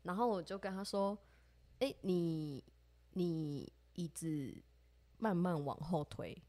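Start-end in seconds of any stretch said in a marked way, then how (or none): 1.8-6.4 soft, very slow and steady, as if not to startle anyone; slow calm coaxing. Retold or imitated. To a friend near a cockroach, slow soft coaxing. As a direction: ease him away gently, word by word.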